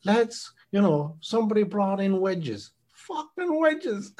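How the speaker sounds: put-on high, drawn-out Limerick accent. Podcast fan quoting his favourite host, imitated Limerick accent. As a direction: imitate a Limerick man, pitched well up. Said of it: "he's very Limerick".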